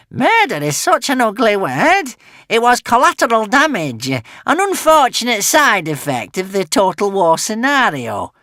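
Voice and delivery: smooth it over, scheming; sly witch. Acted. Witch brushing off murder to Alvin, sly witch voice.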